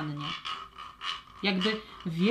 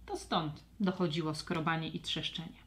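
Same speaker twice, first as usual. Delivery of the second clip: usual speaking voice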